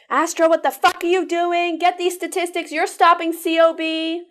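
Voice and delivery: high, loud furious screech; screaming boss on phone. Retold or imitated. Supervisor screaming at Astra over missing statistics, furious high yell. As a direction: scream at her down the phone line. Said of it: "Can you recreate one of those screaming telephone calls?".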